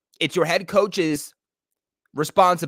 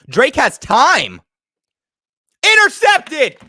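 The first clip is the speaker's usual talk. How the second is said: louder, rising, then a high, loud cry; tense live call; 11 semitones higher, 10 dB louder, much slower than usual. Real, not acted. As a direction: call it live until the pick. Live call ending in interception.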